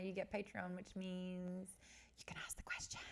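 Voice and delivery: secretive unvoiced whisper.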